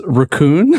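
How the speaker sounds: "raccoon" slow, rising, ruh-COON; "raccoon" drawn out East Coast style. Retold or imitated. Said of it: "I heard somebody from the East Coast recently, uh, pronounce".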